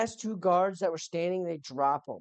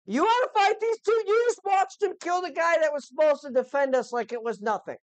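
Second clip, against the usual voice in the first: high, loud, frantic rant; a squeaky hired guard shouting that he quits; panicked merchant guard; 9 semitones higher, 5 dB louder than usual. Acted. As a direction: plead your way out at the top of your voice. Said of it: "he's like".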